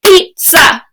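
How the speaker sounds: "Pizza" split into two clapped beats, PIZ then ZA; clapped syllables. Shown as a demonstration. Teacher claps out "Pizza" for her class, syllable by syllable. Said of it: "Can you practice with me?".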